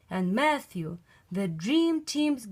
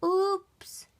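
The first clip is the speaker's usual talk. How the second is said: oops; 10 semitones higher than usual. Acted. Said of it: "cried Froggy".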